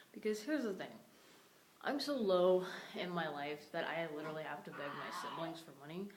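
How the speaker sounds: normal talking voice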